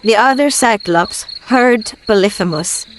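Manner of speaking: ordinary delivery, nothing marked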